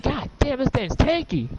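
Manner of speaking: exasperated at a tough enemy; mock-annoyed cry; frustrated. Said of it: "You're like".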